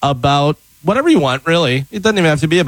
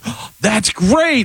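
fake delight; 6 semitones higher, much slower than usual. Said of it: "they're like".

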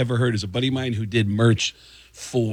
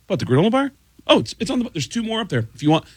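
retold innocent reply; 8 semitones higher, faster than usual.

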